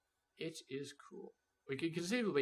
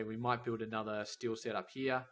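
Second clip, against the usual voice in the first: ordinary talk, nothing marked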